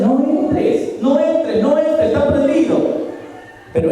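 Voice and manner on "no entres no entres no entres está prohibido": high, urgent repeated plea; pleading warning voice. Retold or imitated. The warning voice pleading with the man, high and urgent. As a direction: beg him again and again not to go in. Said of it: "otra voz decía".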